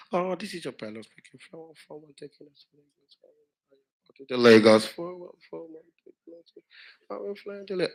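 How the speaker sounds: mumbled pilot announcement. Retold or imitated. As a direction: swallow the words like a bad intercom. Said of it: "this is what you hear".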